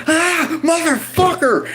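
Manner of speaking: high-pitched scream of pain; yelp, then curse at the top of the voice. Retold or imitated. Patient as the needle goes in, screamed curse. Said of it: "I still screamed a bit".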